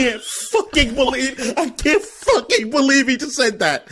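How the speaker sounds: high and laughing; can't believe he just said that, cracking up; laughing disbelief. Real, not acted. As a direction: squeal it out through real laughter. Disbelief at a friend's joke.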